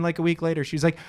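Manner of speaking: usual speaking voice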